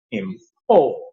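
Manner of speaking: import with PORT stressed, split off after im; im, then a strong falling PORT in import; verb stress. Shown as a demonstration. Teacher showing the verb form, second-syllable stress on import. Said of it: "Look at the verb".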